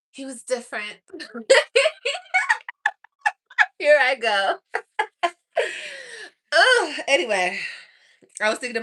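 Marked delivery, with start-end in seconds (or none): 1.0-2.9 laugh. Real, not acted. Laughing at herself. 5.2-7.0 laughing. Real, not acted. Laughing at herself.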